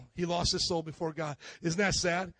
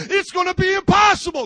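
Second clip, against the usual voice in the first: despairing outcry; 11 semitones higher, 11 dB louder than usual. Acted. Believer despairing outburst.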